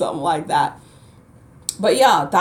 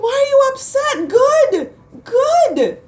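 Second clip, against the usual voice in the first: much higher, laughing "good, good"; gleeful mock scolding; 4 dB louder than usual. Telling upset light workers, a gleeful much higher laughing cry. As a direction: cheer the flood, squealing with laughter. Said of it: "I'm like".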